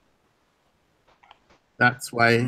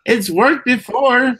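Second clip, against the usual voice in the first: defensive; 7 semitones higher, 3 dB louder than usual. Real, not acted. Defending himself, high.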